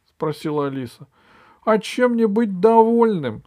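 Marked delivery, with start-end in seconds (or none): 1.6-3.4 high, loud whining complaint; disgruntled king. Acted. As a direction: protest as the sulky king. Acted king grumbles at being cured. (высокий недовольный голос)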